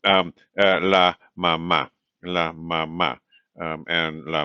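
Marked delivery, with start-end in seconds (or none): 0.8-1.9 "mamá" stressed on the final má; deliberate demo of "mamá" with a strong ending; stress on last syllable. Shown as a demonstration. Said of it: "it's the same for mother too". 2.2-3.2 "mamá" with the final má stressed; teacher's model, weight on the end of "mamá"; stress on last syllable. Shown as a demonstration. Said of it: "it's the same for mother too".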